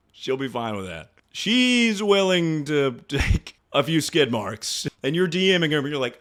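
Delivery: ordinary talk, nothing marked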